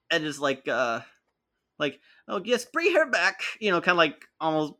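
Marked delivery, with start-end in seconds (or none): 2.3-3.4 overacted high strained whine; hammy stage actor. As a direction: ham it up like a tortured villain. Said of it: "I'm just kind of doing that weird overacting".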